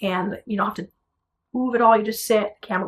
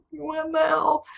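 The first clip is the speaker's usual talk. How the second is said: tearful disbelief; 7 semitones higher, 4 dB quieter, slower than usual. Retold or imitated. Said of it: "went home crying 'cause I was like".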